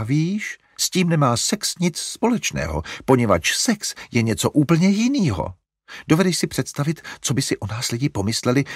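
a fussing mother smoothing things over; fussy mother. A narrator's reading. Mother lecturing her son about Margo, flustered.

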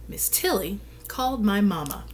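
plain reading voice

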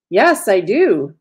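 slow, loud, emphatic insistence; through gritted teeth. A narrator's reading. Cecile answers her taunting conscience, insistent through gritted teeth. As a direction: answer back firmly, punching each word slowly. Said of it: "Cecile gritted her teeth".

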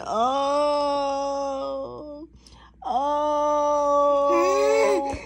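long drawn-out mock moan. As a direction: moan it out steadily. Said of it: "That's moan".

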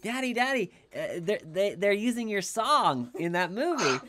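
high excited child voice, lightly imitated; imitating little daughter. Dad imitating his young daughter's excited discovery. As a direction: quote the little girl in her high voice. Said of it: "And she's like".

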